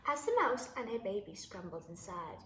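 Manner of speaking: usual speaking voice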